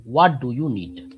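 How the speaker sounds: usual speaking voice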